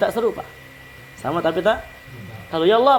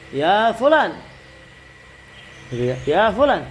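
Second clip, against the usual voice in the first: calling out; 2 semitones higher than usual. Shown as a demonstration. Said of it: "Ini dipakai orang-orang".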